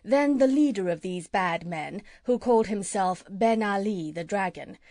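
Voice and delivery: plain reading voice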